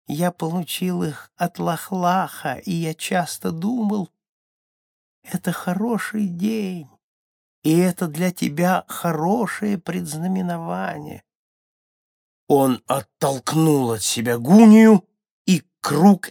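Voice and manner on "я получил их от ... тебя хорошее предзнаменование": high, restrained voice, held evenly; faint remembered voice. A narrator's reading. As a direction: speak high without raising the volume. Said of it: "слышал только слабый треск кузнечика". (высокий негромкий голос)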